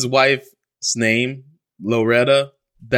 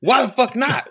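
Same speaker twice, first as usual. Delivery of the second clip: imitating dad angry; 14 semitones higher than usual. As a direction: squeal it up high like the fed-up dad. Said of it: "He's like".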